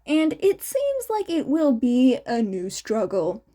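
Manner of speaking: ordinary delivery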